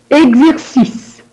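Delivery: normal talking voice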